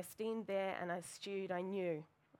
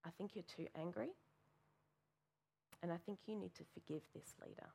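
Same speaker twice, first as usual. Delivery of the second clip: softer, quiet motherly correction; much softer, firm mum; gentle advice; 10 dB quieter, faster than usual. Retold or imitated. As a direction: say it softly and kindly. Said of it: "she actually turned around and went".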